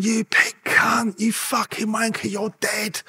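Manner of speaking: high, drawn-out taunting threats; mock jeer with stretched-out words; jeering inmates. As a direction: imitate prisoners calling threats down the wing, slow and high. Imitating inmates' threats as he walks through.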